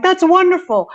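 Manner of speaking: high, bright-pitched gushing delight; gushing neighbors. Retold or imitated. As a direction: gush like a thrilled neighbor. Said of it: "the neighbors are like".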